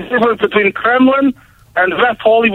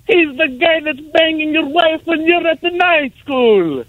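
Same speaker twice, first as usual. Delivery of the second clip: dude's voice; 8 semitones higher than usual. Retold or imitated. Punchline from the dude in the back.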